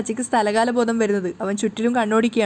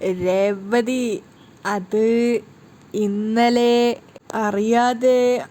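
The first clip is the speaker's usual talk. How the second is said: very slow, halting embarrassed words; stalling, too embarrassed to say it; a husband sheepishly apologising to his wife; much slower than usual. Acted. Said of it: "അവനിങ്ങനെ ചമ്മിക്കൊണ്ട് പറയുന്നുണ്ട്".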